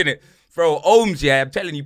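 ordinary delivery

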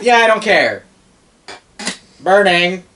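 loud, high dismissive dragon; careless dragon. Pretend. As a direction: yell it like a reckless beast. Voicing the careless fire dragon.

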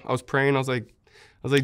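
ordinary talk, nothing marked

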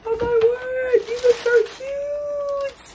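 high, slow squeal of delight. Real, not acted. Opening a gift from her brother, excited high squeal. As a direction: gush over the cute shoes.

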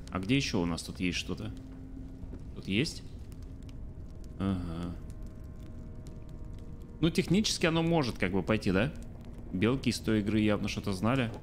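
ordinary talk

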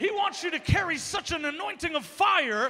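loud, high rallying exhortation; full-force preaching. Acted. Preacher urging congregation to carry the anointing, full force. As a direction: drive it home to the congregation.

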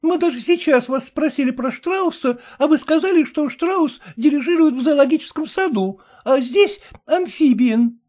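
a touch of eager accusing; Glafira chiming in. A narrator's reading. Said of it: "подхватила Глафира Семёновна".

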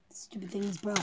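unvoiced whisper. Real, not acted. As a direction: breathe the words. Whispered inside helmet.

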